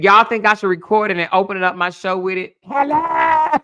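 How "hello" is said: high, sing-song phone greeting; mock prim phone voice; imitating the lady's phone hello. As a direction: answer the phone like that lady, way up high. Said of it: "That's how that lady sound when she answered the phone".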